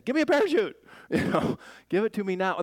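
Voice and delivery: panicked demand. Retold or imitated. Passenger in a crashing plane begging for a parachute, frantic.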